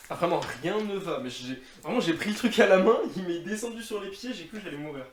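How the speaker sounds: panicked. Real, not acted. Panics as part falls on feet.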